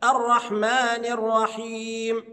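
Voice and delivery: "الرحمن الرحيم" recited slowly, stopping on the m; drawn-out "الرحمن الرحيم" with final mim unvowelled; silent final mim. Shown as a demonstration. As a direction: stretch "الرحمن الرحيم", ar-rahmani r-rahiim. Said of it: "الميم الأخيرة بتاعت الرحيم هتسكن".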